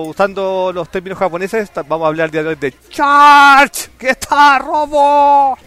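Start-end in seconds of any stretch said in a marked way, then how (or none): 2.9-5.6 higher, louder, slow title call; announcer voice. Acted. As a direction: announce it like a show host. (voz de presentador dramático)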